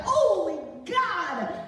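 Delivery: drawn-out shocked cry; startled gasp of disbelief; shocked exclamation. Retold or imitated. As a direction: blurt it out in alarm. Reacting to screaming women.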